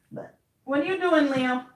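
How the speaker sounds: high, lightly coaxing question to the cat; sing-song talk to a pet. Real, not acted. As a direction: ask the cat playfully. Talking to the cat.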